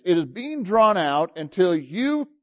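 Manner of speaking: slow, stretched words; drawn out. Shown as a demonstration. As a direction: draw the words out long. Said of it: "Let me tell you what long suffering is".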